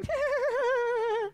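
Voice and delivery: high, thin, held wheeze of laughter; imitated laughter. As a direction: imitate roommates helpless with laughter from next door. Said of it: "I can just hear grown men in the other room".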